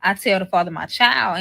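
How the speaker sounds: ordinary talk, nothing marked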